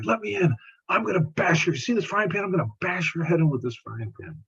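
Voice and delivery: high-pitched, menacing voice of the angry neighbor; threatening neighbor. Retold or imitated. Neighbor threatening with frying pan.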